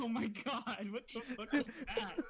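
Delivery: high, laughing disbelief; retelling a startled reaction with a laugh; shocked laughing exclamation. Retold or imitated. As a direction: blurt it out half laughing in shock.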